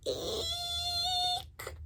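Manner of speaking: very high squeaky eek; tiny eek. Acted. Lion lets out a tiny squeal instead of a roar, very high. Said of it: "let out an".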